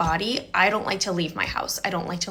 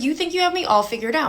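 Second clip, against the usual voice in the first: higher, rising mock-indignant question; indignant challenge; 7 semitones higher than usual. Quoting herself challenging her date, pitched-up indignant question. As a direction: throw the challenge back at him, pitched up.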